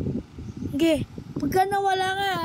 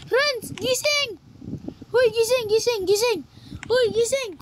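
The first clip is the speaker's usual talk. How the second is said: calling out; 5 semitones higher, slower than usual. Acted. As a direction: shout to wake him up. Shouting to wake Rans.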